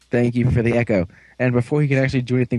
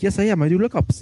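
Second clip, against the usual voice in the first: mock British accent; 9 semitones higher than usual.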